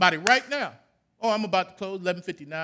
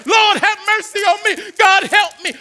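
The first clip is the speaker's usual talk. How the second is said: high, loud plea; crying out; 8 semitones higher, 8 dB louder than usual. Shown as a demonstration. Preacher showing the congregation how to cry out, loud plea. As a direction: cry out to God with everything. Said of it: "we just start saying".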